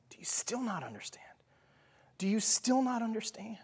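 hushed, urgent whisper; whispered question. Retold or imitated. Jesus' question retold, whispered.

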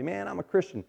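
high, excited announcement; eager new believer. Acted. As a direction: blurt it out like a fresh convert. New believer eagerly telling somebody.

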